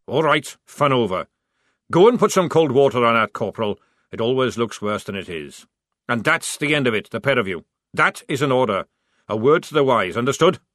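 loud, barking army sergeant; gruff sergeant. Acted. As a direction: bark it like a drill sergeant. Sergeant Jackram barking orders.

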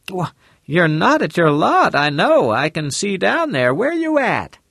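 a concerned lady on the phone; woman's voice. Acted. Mrs Lane voiced high.

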